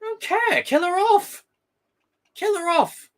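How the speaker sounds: almost shrieking at the writers; high-pitched frustrated demand; exasperated outburst. Real, not acted. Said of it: "his own exasperated rant".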